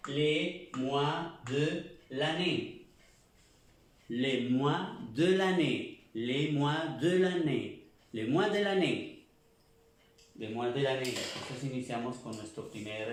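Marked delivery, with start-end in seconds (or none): 0.1-2.9 "Les mois de l'année" slowly, silent s, mwa; drawn out, syllable by syllable; slow French model. Shown as a demonstration. Teacher drilling the class, very slow model phrase. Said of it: "la S no se pronuncia". 4.1-9.3 "Les mois de l'année" repeated, a little slow; teacher drilling, stressing each word; French repetition drill. Shown as a demonstration. Teacher repeating the phrase for students, clear drill. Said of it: "la S no se pronuncia".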